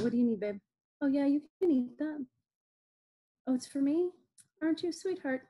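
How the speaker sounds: turned aside to talk to her daughter; turning away from phone. Real, not acted. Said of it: "Sorry, that was my daughter".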